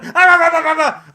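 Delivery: loud high ah-ah-ah blasts; loud cartoon sonic wail; mock sonic whisper. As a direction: blast out a hammed-up sonic scream.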